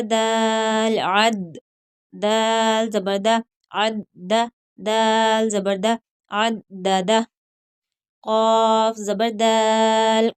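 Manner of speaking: slow, drawn-out letter-by-letter recitation; slow tajweed drill. Shown as a demonstration. Teacher drilling students letter by letter, slow recitation. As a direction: spell and join each syllable like a Quran teacher.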